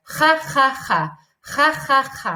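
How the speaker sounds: each "G" a loud, rasping "kha" from the throat; throaty G drill. Shown as a demonstration. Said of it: "Let me hear you say".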